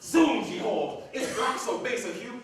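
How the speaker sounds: an indignant roar that breaks into a laugh; furious outburst. Acted. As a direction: roar it in outrage, then scoff with a laugh.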